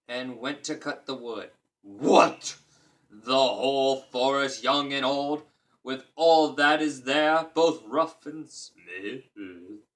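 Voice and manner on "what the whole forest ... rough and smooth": loud, slow booming giant; loud giant lingering on smooth. Acted. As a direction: boom out the disbelief. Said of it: "how a giant would sound".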